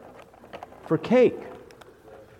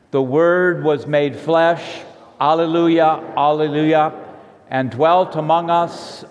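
liturgical recitation. Real, not acted. Pastor leads congregation in affirmation of faith, solemn recitation. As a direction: declaim it steadily, drawing out each phrase.

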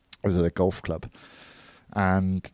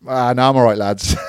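imitating Jamie; 4 semitones higher, 5 dB louder than usual. Jamie voiced refusing.

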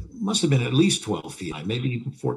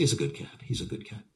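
fading to a hushed whisper; soothing repetition; 9 dB quieter, faster than usual. Retold or imitated. Handlers soothing the tiger, fading to a whisper. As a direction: murmur it softer each time, calming the cat. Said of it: "they say".

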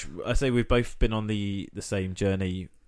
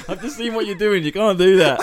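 laughing; 9 semitones higher, 7 dB louder than usual. Real, not acted. Laughing at friend's impression.

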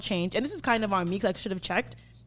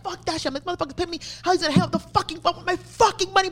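high-pitched re-enacted tirade; going off; 8 semitones higher, faster than usual. Furious customer ranting in the car about money owed, going off. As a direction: rant in outrage, voice riding high. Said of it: "I'm going off".

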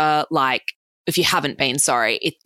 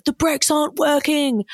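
put-on panicked boyfriend; 11 semitones higher than usual. Boyfriend speeding downhill, panicking.

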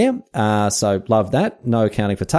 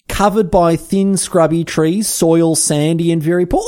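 higher, slower, solemn reading of the diary; reading Darwin's diary; 7 semitones higher, slower than usual. Acted. Host reads Darwin's diary aloud to listeners, in a mock solemn higher voice. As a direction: intone Darwin's words with mock gravity. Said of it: "here we go".

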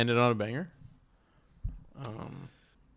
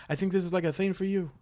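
put-on failed Italian accent; 7 semitones higher, 4 dB quieter than usual. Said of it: "I can't do the Italian accent".

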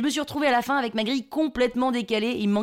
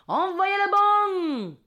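high, long-drawn imitated yell; hollered bingo cry; 8 semitones higher, much slower than usual. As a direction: holler it slowly across the hall. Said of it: "j'entends mon voisin hurler". (cri de bingo hurlé et traînant)

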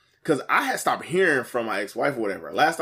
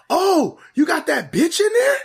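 high, loud mock screech of an ex-wife; imitated yelling; 6 dB louder than usual. Ex-wife outside the apartment at dawn, yelling in imitation. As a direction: mimic her hollering, pitched way up. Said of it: "all I hear is yelling outside".

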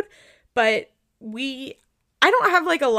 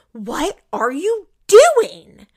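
loud, slowed, higher irritated snap; mock snapping; 7 semitones higher, 9 dB louder, much slower than usual. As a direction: imitate the husband snapping at his wife. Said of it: "totally snaps on her".

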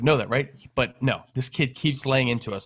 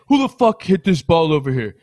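retold confrontation, louder and higher; mock angry demand; 4 semitones higher, 7 dB louder than usual. Irate stranger confronting his brother over a ball, mock angry demand. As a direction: imitate an irate golfer demanding answers. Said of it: "this guy's talking shit to my brother".

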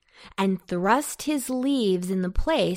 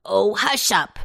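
loud, scolding hush; crying out; 5 dB louder than usual. A narrator's reading. Pumpkin silences Potato mid-plea, crying out. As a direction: shut him up with a raised voice. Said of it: "cried Pumpkin".

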